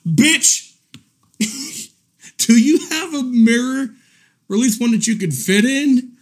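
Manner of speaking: high, amused outrage with pauses; incredulous roast. Real, not acted. Roasting the streamer who called him fat, incredulous. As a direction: hurl astonished questions, half laughing.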